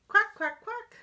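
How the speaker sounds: high, loud little quacks; happy duckling quack. Acted. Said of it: "When I'm feeling happy, I say".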